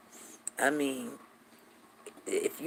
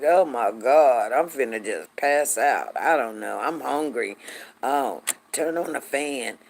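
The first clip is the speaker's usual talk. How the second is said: low, slow, worn-out whine; miserable pregnant moaning; 5 semitones lower, slower than usual. Retold or imitated. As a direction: groan it slowly, uncomfortable and fed up. Voices a miserable pregnant woman.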